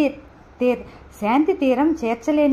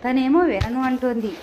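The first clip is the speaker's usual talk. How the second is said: plain reading voice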